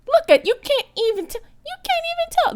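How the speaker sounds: high, loud and delighted; excited squeal. Real, not acted. Showing viewers the wig's invisible hairline, excited squeal.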